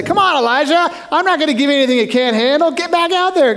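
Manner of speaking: high, impatient urging; hammed-up drill to get moving; mock pushy God. What God doesn't say to Elijah, high pushy urging. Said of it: "God doesn't come and say".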